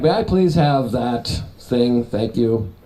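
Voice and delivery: low, slow, polite order; measured, mock-courteous request; prim politeness. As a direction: ask slowly with exaggerated good manners. Models polite ordering against rude one.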